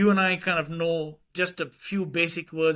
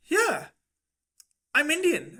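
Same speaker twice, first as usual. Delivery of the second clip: lightly voiced kid, pitched high; higher-pitched kid impression; imitating young son; 10 semitones higher than usual. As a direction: retell it in your son's higher voice. Dad voices his young son.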